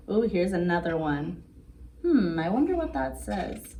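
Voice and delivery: usual speaking voice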